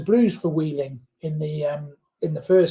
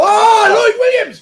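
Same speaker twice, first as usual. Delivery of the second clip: excited shout; 8 dB louder, slower than usual. Real, not acted. Live commentary, break develops.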